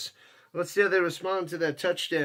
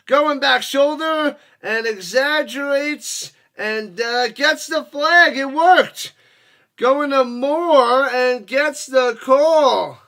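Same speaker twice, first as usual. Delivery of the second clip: loud, raised, drawn-out game call; excited play-by-play; 6 semitones higher, 8 dB louder, slower than usual. Real, not acted. Sportscaster calling a drawn flag to viewers, excited. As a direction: stretch the call out with rising volume.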